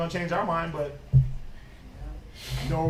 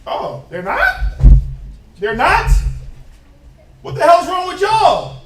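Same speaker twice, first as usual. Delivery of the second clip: higher, louder mock disbelief; hammed-up louder outrage; incredulous teasing; 11 semitones higher, 11 dB louder than usual. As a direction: rib them in mock shock. Mocking congregation, laughing.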